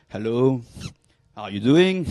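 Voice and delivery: trying to sound sexy to a woman; mock suave pickup line. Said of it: "very attractive walk".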